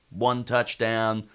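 ordinary talk, nothing marked

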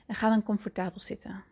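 hushed and calm, breathy at times; soft spa whisper. Acted. Leads a guided meditation.

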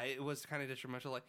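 ordinary talk, nothing marked

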